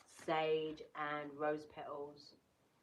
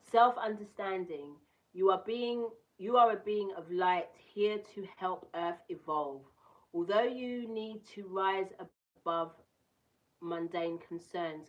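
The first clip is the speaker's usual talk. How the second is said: louder, proclaiming the card's text; card reading voice; 3 semitones higher, 9 dB louder, slower than usual. Acted. Reading the card's message to viewers, proclaiming. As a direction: deliver the message like an oracle.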